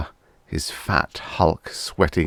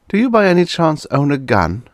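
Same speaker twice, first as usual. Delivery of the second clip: high, loud, sly question; sly boss voice; 10 semitones higher, 5 dB louder than usual. A narrator's reading. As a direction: fish for the answer.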